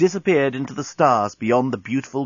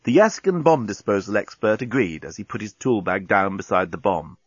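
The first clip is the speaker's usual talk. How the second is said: ordinary delivery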